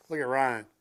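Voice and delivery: usual speaking voice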